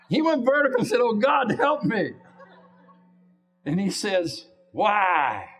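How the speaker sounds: very high, loud pulpit climax; soaring revival-preacher peak; preaching at full force. Acted. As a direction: cry it out to the rafters.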